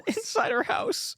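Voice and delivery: slow, high disbelieving repeat; incredulous echo. Real, not acted. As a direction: stretch it out in disbelief.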